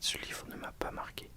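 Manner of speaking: ordinary talk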